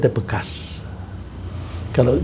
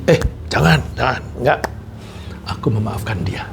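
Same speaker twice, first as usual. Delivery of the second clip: hushed self-correction. Pretend. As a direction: drop to a near whisper on the last words. Checking oneself, forgiving.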